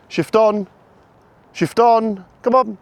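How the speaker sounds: high, loud repeated calls; barking commands. Real, not acted. Owner barking repeated commands at his dog, high and loud. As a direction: keep barking it out until the dog moves. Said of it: "as I'm barking verbals".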